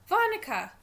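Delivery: put-on childish, insistent call; little boy calling. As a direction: call for the babysitter like a young child. Little brother calls babysitter.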